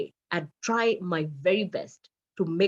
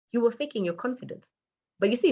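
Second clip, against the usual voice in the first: normal talking voice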